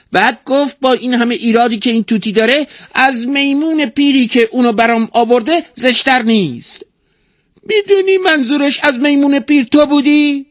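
very high, loud parrot voice; high-pitched, indignant tale-telling bird; talking parrot, aggrieved. A narrator's reading. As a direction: squawk out your complaints as a tattling parrot. Narrator plays the talking parrot.

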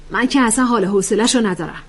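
ordinary delivery

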